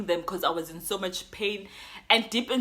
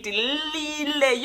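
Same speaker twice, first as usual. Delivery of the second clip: retold scream; 6 semitones higher, much slower than usual. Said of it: "I would scream again".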